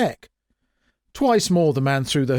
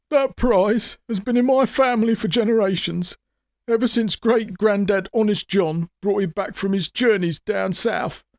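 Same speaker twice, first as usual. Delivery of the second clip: higher, tearful pleading; sobbing; 8 semitones higher than usual. A narrator's reading. Showman begs Grammer for the heirloom, sobbing. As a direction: beg through mock tears. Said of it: "sobbed the showman".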